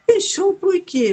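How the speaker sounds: slow, high, puzzled question; retold disbelief. Mother asks daughter about the closed house, incredulous. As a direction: act out a drawn-out, incredulous query.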